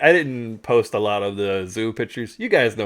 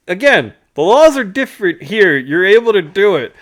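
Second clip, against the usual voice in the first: louder, higher, insistent retold reply; exasperated retort; 8 semitones higher, 7 dB louder than usual. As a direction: press the point, pitched up and loud.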